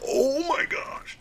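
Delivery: high, drawn-out exasperated outburst; retold exasperation. Player retelling his reaction to his son, drawn-out high exasperation. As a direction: relive the dismay in a thin, high voice. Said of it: "and I'm like".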